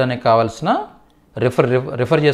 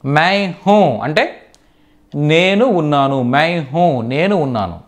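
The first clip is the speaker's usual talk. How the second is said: మై హూ said very slowly and evenly, each word apart; slow model sentence; slower than usual. Shown as a demonstration. Teacher slowly modelling the first Hindi sentence. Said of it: "teacher modelling sentence".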